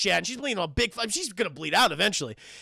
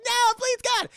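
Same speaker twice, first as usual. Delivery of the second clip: very high, loud begging; mock desperate plea; 5 dB louder than usual. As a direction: cry out for mercy. Victim begs.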